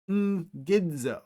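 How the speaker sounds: ngidzo starting with a nasal ng. Shown as a demonstration. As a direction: begin ngidzo with ng through the nose. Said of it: "gamma makes a ng sound".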